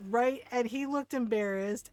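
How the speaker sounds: usual speaking voice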